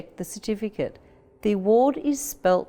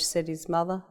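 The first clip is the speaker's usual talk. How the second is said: plain reading voice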